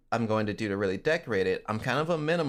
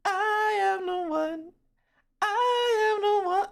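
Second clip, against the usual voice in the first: very high, slow put-on croon; high, slow mock pop-star croon; 4 dB louder, slower than usual. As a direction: croon it way up like the modded Hunter. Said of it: "walks around and goes".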